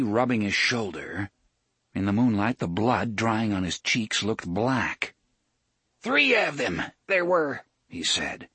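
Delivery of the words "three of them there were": put-on high, sly old man. A narrator's reading. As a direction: tell it like a cagey old drunk.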